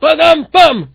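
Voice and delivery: loud, high imitation drum sting; sung-out comic rimshot; vocal rimshot. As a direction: punch out a joke-ending drum hit. Imitates rimshot after the punchline.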